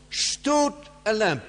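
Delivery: "Stood" loud and high, pause, then falling; slow, punched "Stood" before "a lamb"; stress on STOOD. Shown as a demonstration. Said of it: "the word "stood" is the first part".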